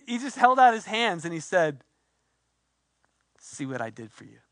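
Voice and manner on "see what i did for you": low, very soft voicing of Jesus; hushed and intimate, much lower; gentle, hushed. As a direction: offer it quietly, as a tender reveal. Jesus showing his wounds, voiced gently.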